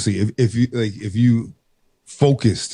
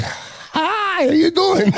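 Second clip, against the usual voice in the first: much higher, exaggerated cheery voice; mock sing-song greeting; much slower than usual. Greeting a Chinese person, high mock cheerful voice. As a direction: overdo a chirpy hello. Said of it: "I'm like".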